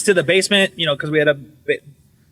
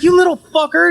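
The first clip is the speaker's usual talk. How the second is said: high-pitched indignant cursing; hammed-up accusing squeal; mock outrage; 13 semitones higher, 4 dB louder than usual. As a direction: curse the culprit, voice shooting up. Acts out cursing supposed culprit.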